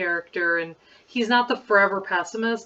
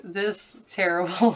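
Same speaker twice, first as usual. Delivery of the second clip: ordinary delivery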